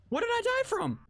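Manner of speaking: sudden death, shocked high cry; shocked disbelief. Real, not acted. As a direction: squeak it out in shock.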